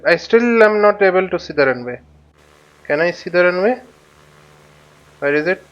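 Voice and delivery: ordinary delivery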